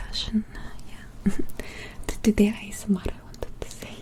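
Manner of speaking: put-on whispered Russian-accented English; halting ASMR whisper with a Russian accent. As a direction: talk from the heart in a careful Russian accent, whispering. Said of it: "focus so much on my Russian accent".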